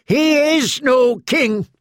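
very high, slow cry; cried out. A narrator's reading. Knight proclaims against the wounded king, high slow cry. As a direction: proclaim it with fervor. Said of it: "he cried".

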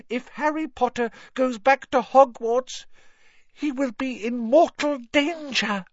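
high, pleading little elf; squeaky anxious house elf. Acted. Dobby the elf speaking.

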